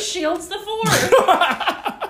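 mock dismay breaking into laughter; hammed-up horrified friend. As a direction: play the shocked friend, stretching out the name. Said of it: "I can picture his friend coming down like".

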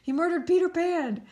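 high, grave mock-serious announcement; high, solemn mock-serious voice; mocking the detectives' solemn line. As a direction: deliver it like a solemn news report you can barely keep straight. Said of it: "they're like".

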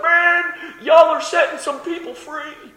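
high-pitched whooping praise; acted cheering. Preacher cheering the trash truck guys, high excited holler.

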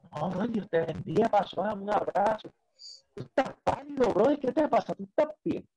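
high-pitched imitation of fussing concern; concerned brother. Pastor brother checking on his pale coworker, concerned. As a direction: imitate a worried friend in a high voice.

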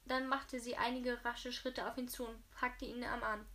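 ordinary delivery, nothing marked

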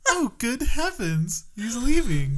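high, slow, dismayed robot butler; high-pitched mock distress; C-3PO. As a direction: wail in alarm like a fussy protocol droid. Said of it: "just a giant C three P O".

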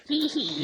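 high, silly imitated giggle of an annoying kid; mock kid giggle. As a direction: mimic a bratty child's giggle. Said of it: "He's like".

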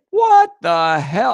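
slow, loud mock outrage; drawn-out indignant what the hell; outraged. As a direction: blurt it out in stunned outrage. Imagined outraged reaction.

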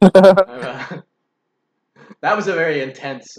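laughing while speaking; embarrassed laugh through words. Real, not acted. Laughs at own letter.

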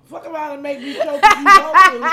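laughing, food in the mouth; mouth full. Real, not acted. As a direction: talk through a mouthful while laughing. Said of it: "when I got shit in my mouth".

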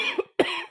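real cough; short dry cough. Real, not acted. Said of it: "See, she's got it".

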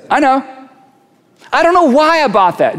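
a lightly voiced puzzled self; mock confession. People puzzling over their own choices, mildly baffled. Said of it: "Jeremiah would say".

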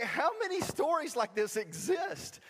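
high, drawn-out incredulous question; exasperated disbelief. Retold or imitated. Son to parents who keep recalling his bad moments, exasperated. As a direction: slowly plead in high-pitched exasperation.

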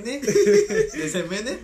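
high, slow, laughing question. Real, not acted. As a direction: dissolve into laughter on it. Cracks up mid-joke.

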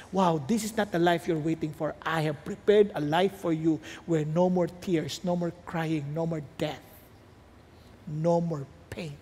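Jesus gently comforting believers; tender promise. Acted.

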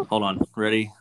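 usual speaking voice

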